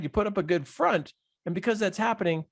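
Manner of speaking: ordinary delivery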